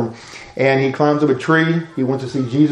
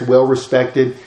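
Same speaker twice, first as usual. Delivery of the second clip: ordinary talk, nothing marked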